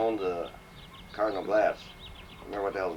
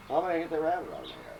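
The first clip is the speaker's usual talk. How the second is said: higher and fast, lightly voiced fussy woman; fussy caller; 7 semitones higher, much faster than usual. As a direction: rattle it off as the nagging caller.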